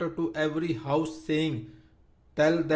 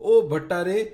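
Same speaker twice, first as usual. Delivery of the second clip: crying out; 3 semitones higher, 8 dB louder than usual. A narrator's reading. Venkamma thanks the priest for the match, crying out loud. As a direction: burst out gratefully, pitch up. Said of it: "And Venkamma cries out".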